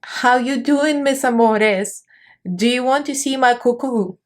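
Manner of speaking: cheeky diva entrance line; quoting the queen. Retold or imitated. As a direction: tease the audience like the queen. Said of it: "she said".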